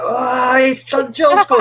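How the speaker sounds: high, loud retold cheer at a goal; excited celebration. Fan retelling his goal cheer, high excited yell. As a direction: relive yelling that Sancho scored. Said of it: "And I'm like".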